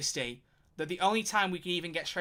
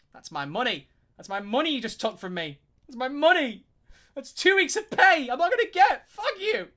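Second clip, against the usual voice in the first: rising from plain to a much higher, louder outburst; retold tutor ranting at cancelled lessons, growing to a shout; building mock rant; 10 semitones higher, 10 dB louder than usual. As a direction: escalate into a hammed-up yell over lost pay. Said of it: "I'm like".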